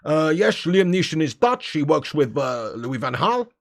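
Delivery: put-on joking Dutch accent with slushy s sounds; hesitant, hammed-up Dutch English; mock Dutch accent. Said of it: "That's how he talks, doesn't it?".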